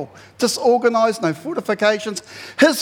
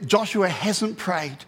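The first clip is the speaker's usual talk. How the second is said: ordinary talk, nothing marked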